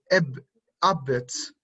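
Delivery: drawn out abwärts for the class; slow, careful pronunciation. Shown as a demonstration. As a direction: say abwärts slowly, syllable by syllable. Pronunciación lenta de la palabra. (abwärts pronunciado despacio, sílaba por sílaba)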